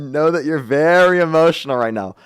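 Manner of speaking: exaggerated breathing-coach condescension; mock soothing. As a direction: patronizingly talk them down, stretching the words. Host mockingly coaches guest to calm.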